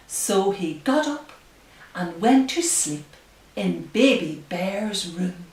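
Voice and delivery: slow, hushed storybook reading; reading to children. Acted. Reads picture book aloud.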